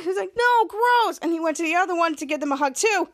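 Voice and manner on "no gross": high mock-horrified protest; screaming protest. Muchacho getting a sweaty hug, high mock protest. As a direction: cry out in pretend disgust. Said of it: "And he screams like".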